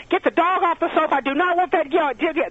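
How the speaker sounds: high, rattled-off nagging yell; scolding mother. Acted. Guest mimics mothers yelling about the dog, high scolding. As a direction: voice a mom scolding in a high pitch. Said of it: "the mothers are like".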